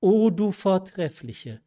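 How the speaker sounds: moved outcry; fervent exclamation. A narrator's reading. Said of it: "rief sie".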